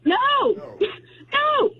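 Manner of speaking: high, drawn-out retold no; exasperated refusal. Woman retelling how she refused the ex's gift, exasperated. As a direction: hammer home a horrified refusal.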